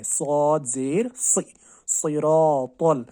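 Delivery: सिरातल with a thick, heavy "si", slow; heavy saad. Shown as a demonstration. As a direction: recite सिरातल slowly, heavy s. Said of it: "मोटा पढ़ना है".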